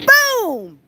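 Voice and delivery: sudden high, loud impact noise; explosive boom. Retold or imitated. Storyteller acting out the hawk hitting the dove, explosive. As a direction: boom it out as a shock.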